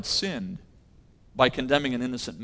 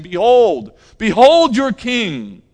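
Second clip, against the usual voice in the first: loud, mocking proclamation, higher; mocking ridicule; 7 semitones higher, 9 dB louder, slower than usual. Pilate ridiculing the crowd: loud mocking higher voice. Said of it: "to ridicule them, saying".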